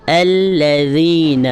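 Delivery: drawn-out الذین, dh made between the teeth; tongue between teeth. Shown as a demonstration. Teacher modelling the makhraj of ذ, slow and clear. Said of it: "زبان کی نوک ... دانتوں سے ... باہر آئے گی".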